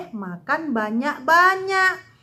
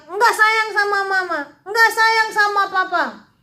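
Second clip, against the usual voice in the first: mock pouting child, higher pitch; sulky child; 6 semitones higher than usual. Teacher warning kids, imitating a sulky child. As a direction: whine like a kid who did not get cake. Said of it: "Jadi jangan suka ngambek ya".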